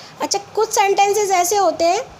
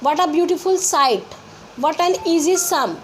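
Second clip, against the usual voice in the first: very slow, word by word; slow dictation; much slower than usual. Shown as a demonstration. Teacher dictating exclamation examples. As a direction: read each example slowly. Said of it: "इसमें आ का use करेंगे".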